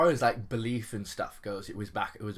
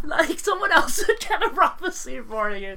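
laughing; 10 dB louder than usual. Real, not acted. Laughing at absurd prophecies.

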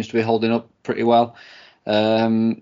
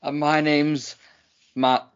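ordinary talk, nothing marked

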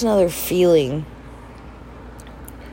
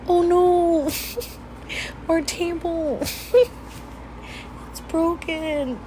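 laughing; 11 semitones higher, 5 dB quieter, slower than usual. Retold or imitated. Laughing at broken table.

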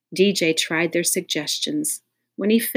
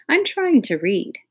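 higher voice; 10 semitones higher, 4 dB louder than usual. A narrator's reading. Dana annoyed while reading.

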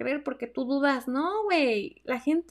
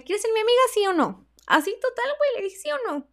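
much higher, a touch louder; excited high ask; 10 semitones higher, 4 dB louder than usual. Retold or imitated. Retelling asking her friend yes or no, high and excited. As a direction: blurt it out eager. Retells bold question again.

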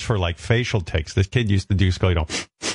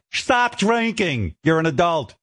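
exasperated rant; 6 semitones higher than usual. Pretend. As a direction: bellow the order in comic exasperation. Mock lecture to addicts.

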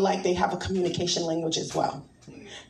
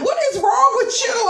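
higher, loud exasperated cry; mock shouted disbelief; 13 semitones higher, 5 dB louder than usual. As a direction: blast it like she can't believe you. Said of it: "She's looking at me like".

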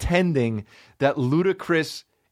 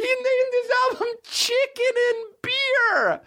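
high, cracking mock cry; hammed-up cry of disbelief; mock wail. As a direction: wail in fake despair. Mock anguish at lazy title.

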